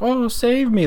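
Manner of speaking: mock a princess begging for rescue; parodying the helpless princess trope; mock damsel in distress. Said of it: "like, all, like".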